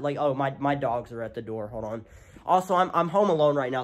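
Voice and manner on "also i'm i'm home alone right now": louder than usual for the mic; talking extra loud. Real, not acted. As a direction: project so viewers can hear. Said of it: "that's why I'm talking so loud".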